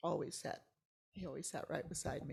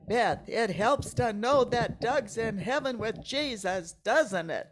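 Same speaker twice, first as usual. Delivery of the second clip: much louder and slower, right in her face; emphatic insistence; 6 semitones higher, 15 dB louder, slower than usual. Retold or imitated. David pressing comfort face to face, loud and slow. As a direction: lean in and boom it slowly. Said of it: "and he goes".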